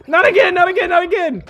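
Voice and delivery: high, loud yell of despair; frustrated scream. Real, not acted. Gamer yelling at his own death, furious scream. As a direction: scream it at the screen. Dying again in game, screaming.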